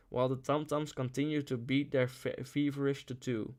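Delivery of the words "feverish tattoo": usual speaking voice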